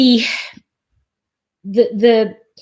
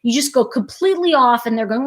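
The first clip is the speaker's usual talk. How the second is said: normal talking voice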